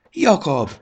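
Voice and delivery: high, loud joyful call. A narrator's reading. Módusz calling Jakab from the meadow, loud. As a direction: shout the name across to him. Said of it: "kiáltotta".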